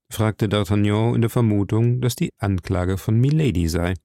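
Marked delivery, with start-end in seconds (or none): none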